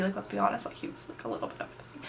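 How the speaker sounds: hushed, breathy aside about him; whispering a catty aside; whispered aside. Real, not acted. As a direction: drop to a whisper to dish.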